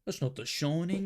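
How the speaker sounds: mock the British with a rounded "Shoning"; mock British accent. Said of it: "British people be like".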